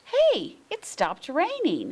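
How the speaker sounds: high, loud happy cry; cried out. A narrator's reading. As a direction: call out with delight that it stopped. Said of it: "he cried".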